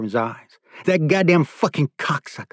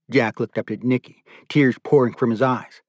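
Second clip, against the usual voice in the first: usual speaking voice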